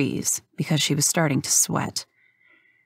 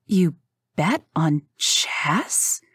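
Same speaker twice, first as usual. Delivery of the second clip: slow, breathy mock gasp; fake shocked whisper; much slower than usual. Rook faking shock to hook Hank, whispered. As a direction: pretend to be scandalised. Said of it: "a gasp she hoped sounded real".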